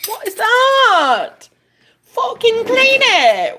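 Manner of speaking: high, loud disgusted yell breaking into a whispered laugh; outraged shout. Real, not acted. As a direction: shriek at the mess, then laugh in disbelief. Ranting at dirty dishes left out.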